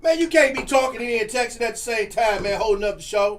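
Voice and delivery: loud call-out at a texting co-host; loud teasing. Real, not acted. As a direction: bark it at your buddy who's holding up the show.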